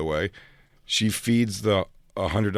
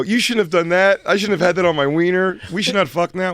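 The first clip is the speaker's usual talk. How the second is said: high panicky warning; 7 semitones higher, 4 dB louder than usual. Retold or imitated. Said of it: "and I go".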